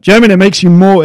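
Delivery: loud, high, carried-away talk; outside voice. Real, not acted. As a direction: talk too loudly, as if outdoors. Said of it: "you need to use the inside voice".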